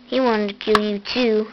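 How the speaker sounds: normal talking voice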